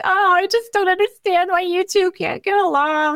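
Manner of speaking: lifted, high plaintive mother voice; mom's voice. Retold or imitated. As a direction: play the sighing, puzzled mother. Mom voiced in phone story.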